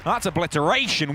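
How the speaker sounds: big hype shout, high and loud; esports caster. Real, not acted. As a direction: roar the highlight at the crowd. Shouting the round-winning play.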